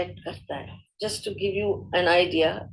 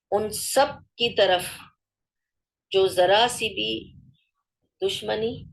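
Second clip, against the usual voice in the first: ordinary delivery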